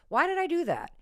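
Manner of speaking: high-pitched self-reproach; lightly acted morning-after lament; retold regret. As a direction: pitch up as if scolding yourself.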